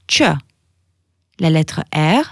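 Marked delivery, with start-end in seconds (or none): 0.1-0.4 t plus ch plus eu for ch; ch as "tchuh"; Albanian Q sound. Shown as a demonstration. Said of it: "ça se prononce".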